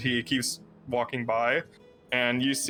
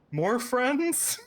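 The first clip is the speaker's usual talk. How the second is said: higher, tired mock question; weary grandmother; 12 semitones higher than usual. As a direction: ask it with a sigh of fatigue. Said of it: "Looks over and says".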